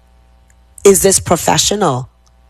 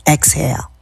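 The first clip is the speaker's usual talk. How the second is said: usual speaking voice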